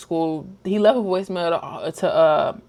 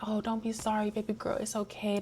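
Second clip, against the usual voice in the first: put-on much softer, gentle reassuring to a child; soft comforting daddy; 12 dB quieter than usual. Father kneeling to comfort upset daughter, soft and tender. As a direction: hush it like a father soothing his little girl. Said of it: "talking to her like".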